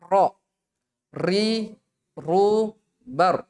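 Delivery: ro ri ru bar with an overlong rolled R; ro ri ru bar, R trilled too much; wrong trilled ro. Shown as a demonstration. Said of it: "keliru kalau misalnya dibaca".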